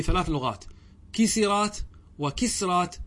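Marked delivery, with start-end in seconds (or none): 1.1-1.8 كسرات said "kisirāt", higher, i after the s; kasra on the sin. Shown as a demonstration. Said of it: "والفتح والكسر". 2.2-3.0 وكسرات said "wa-kisrāt", no vowel after the s; sukun on the sin. Shown as a demonstration. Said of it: "بالسكون".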